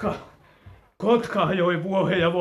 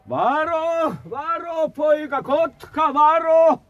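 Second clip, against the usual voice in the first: high, desperate warning yell; a father yelling down to his son about the eagle; warning shout; 13 semitones higher, 4 dB louder, slower than usual. Acted. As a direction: scream the warning down the cliff. Father warns of the diving eagle.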